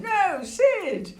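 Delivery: high mock-dismayed call; dismayed cry. Narrator scolding Sid for dropping the egg, high dismayed cry. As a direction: cry out in playful alarm.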